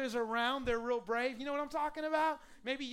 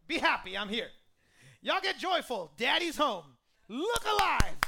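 loud, booming hearty announcement; mock big entrance; 8 dB louder than usual. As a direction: burst in shouting cheer at the whole room. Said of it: "he don't do any of that".

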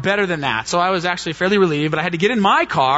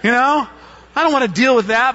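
mock whine; 7 semitones higher, 3 dB louder than usual. Pleading with the Holy Spirit.

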